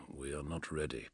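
low, very quiet male growl; hushed, low grumble of admission; soft growl. A narrator's reading. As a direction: growl it softly under your breath. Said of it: "he growled softly".

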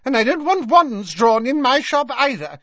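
high, hasty scolding. A narrator's reading. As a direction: add a hurried reproof in a high put-on voice. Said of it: "she added hastily".

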